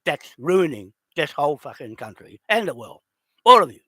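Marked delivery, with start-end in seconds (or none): 3.4-3.8 a sudden loud, furious burst; angry shout. Real, not acted. Ranting at the Pākehā occupiers, furious shout. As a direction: hurl it at them all.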